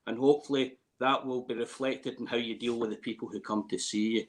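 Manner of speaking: usual speaking voice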